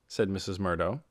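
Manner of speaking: usual speaking voice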